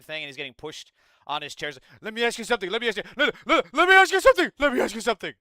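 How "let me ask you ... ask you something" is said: much higher, louder, laughing, sputtering; Michael Knowles impression. Streamer mocks Michael Knowles stammering for airtime, laughing squeaky impression. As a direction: squeak it out faster and faster, cracking up. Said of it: "That's Michael Knowles".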